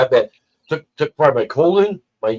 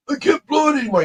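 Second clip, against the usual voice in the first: very high, squeezed voice; strained protest. Retold or imitated. Patient told to blow up a balloon, high strained protest.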